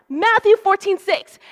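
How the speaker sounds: high, loud call-out; youth leader shout. Retold or imitated. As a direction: shout it out like a challenge to the group. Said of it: "youth leader would shout out".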